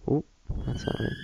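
ordinary talk

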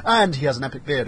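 ordinary talk, nothing marked

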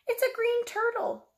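high, delighted announcement; cheerful reveal for the kids; excited reveal. Acted. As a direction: present the turtle like a surprise. Revealing animal to children.

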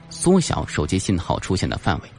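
ordinary delivery, nothing marked

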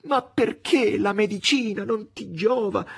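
high, slow tearful plea; almost weeping; anguished, tearful. A narrator's reading. Margherita clinging to the master, high tearful anguish. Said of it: "angosciata e piangente".

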